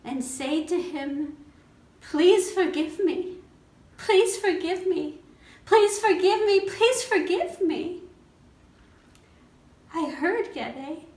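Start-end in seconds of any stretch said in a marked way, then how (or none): 2.0-8.0 high, loud, urgent begging; desperate pleading. Acted. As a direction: plead louder and more urgently each time. Begging dead father for forgiveness.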